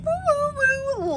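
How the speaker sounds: high gleeful whoo-whoo; excited whoops. Real, not acted. Unboxing her new weed tool.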